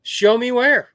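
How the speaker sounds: loud, high acted retort; retold pushback, punched out loud; defiant challenge. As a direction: imitate someone demanding proof. Chandler challenging the refusal, retold.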